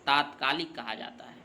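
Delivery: usual speaking voice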